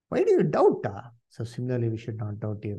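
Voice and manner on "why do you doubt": high, loud mild rebuke; a touch of Jesus chiding Peter; Jesus rebuking. Acted. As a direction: lift the question like a gentle scold.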